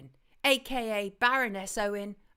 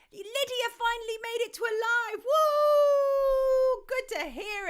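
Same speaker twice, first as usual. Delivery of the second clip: much higher, thrilled cheer; excited welcome; 12 semitones higher, 5 dB louder than usual. Real, not acted. Host greets a viewer finally at a live, excited. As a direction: celebrate her arrival with a loud woo.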